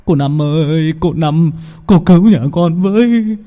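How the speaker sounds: high loud begging; pleading lament. Acted. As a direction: plead with the shaman for help. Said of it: "tế như tế sao kể lể".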